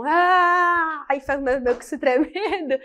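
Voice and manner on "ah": long, higher, louder mock chant cry; imitated ritual cry. Teacher imitating a tribe's welcome, long ritual cry. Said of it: "e aí eles ficam".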